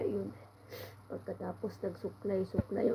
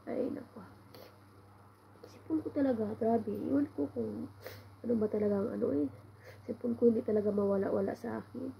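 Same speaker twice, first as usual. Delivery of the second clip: usual speaking voice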